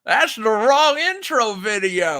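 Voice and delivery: put-on slow, high, loud grumpy old man. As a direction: complain like a doddering old man. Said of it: "this old man thing here".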